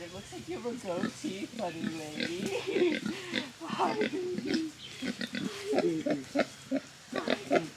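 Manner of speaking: high soft sing-song cooing; doting pig-mom voice. Real, not acted. Baby talk to pig.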